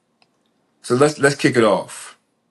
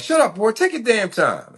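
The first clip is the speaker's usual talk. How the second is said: high, sharp mother's voice; high-voiced impatient mother; scolding mom; 11 semitones higher than usual. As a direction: snap at the boy like his mom. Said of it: "My mom was like".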